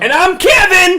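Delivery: very high, loud shouted sign-off. Real, not acted. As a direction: yell your name out.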